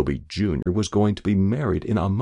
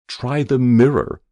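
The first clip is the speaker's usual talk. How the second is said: lightly voiced ominous order, raised and louder; distant terrible voice; 5 semitones higher, 6 dB louder than usual. A narrator's reading. Doctor checking a dying man for breath, mildly ominous voice. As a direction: call it out with a touch of dread. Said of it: "said a distant, terrible voice".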